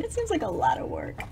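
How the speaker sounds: soft unvoiced aside, a bit fast; whispered aside. Real, not acted.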